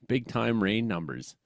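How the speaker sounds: ordinary talk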